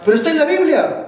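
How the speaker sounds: loud, high, rapid-fire objection; protesting objector. Acted. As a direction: burst in like someone contesting the preacher. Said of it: "Pero cualquiera dirá". (objeción airada, aguda y fuerte)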